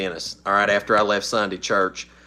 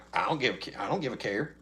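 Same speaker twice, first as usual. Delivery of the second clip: quiet mock shrug; 8 dB quieter, faster than usual. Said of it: "I'm like".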